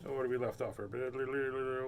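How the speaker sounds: ordinary delivery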